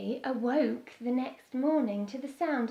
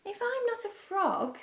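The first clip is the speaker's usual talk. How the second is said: small bird voice; 9 semitones higher than usual. Acted. Beaky voiced in story.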